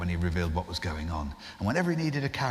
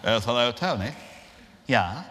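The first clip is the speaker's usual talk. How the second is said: higher, louder chummy Hague with laughter breaking in; William Hague impression; 5 semitones higher, 3 dB louder than usual. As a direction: chat breezily as Hague, barely holding a laugh. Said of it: "it's William Hague".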